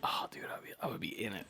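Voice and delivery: hushed, breathy whisper. Real, not acted. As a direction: whisper it like a secret craving. Excited whispering about bacon.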